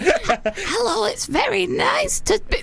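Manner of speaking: high, hammed-up old lady; put-on old woman's voice; elderly voice. Shown as a demonstration. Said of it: "I'm trying to be elderly as well".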